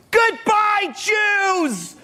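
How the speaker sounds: put-on very high shrill child's shout; little girl yelling. Little girl on a mailbox jeering at people leaving, shrill yell. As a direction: shriek it like a small girl. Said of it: "and she's yelling".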